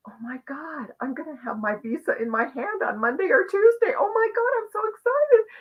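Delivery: excited squeal rising on so excited; retold excitement. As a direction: replay your own giddy rush. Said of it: "all this energy of".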